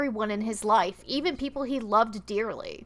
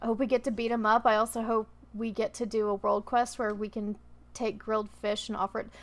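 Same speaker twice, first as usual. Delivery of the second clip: ordinary talk, nothing marked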